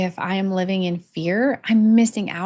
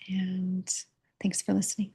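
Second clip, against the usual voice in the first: whispered; 7 dB quieter than usual. Real, not acted. Soft sign-off of the vlog.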